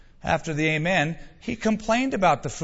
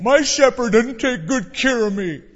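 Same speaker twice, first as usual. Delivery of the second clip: high, loud mock whine of complaint; complaining sheep; 7 semitones higher, 6 dB louder than usual. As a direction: protest like a hard-done-by sheep.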